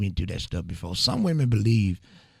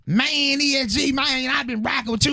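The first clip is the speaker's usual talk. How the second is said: high-pitched mock rap-fan girl; mixtape-style girl. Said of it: "she talking like she making a mixtape".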